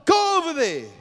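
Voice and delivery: preacher showing how to command money; aggressive command. Shown as a demonstration. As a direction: order the money to bring it. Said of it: "Tell the money".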